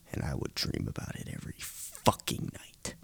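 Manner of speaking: ordinary talk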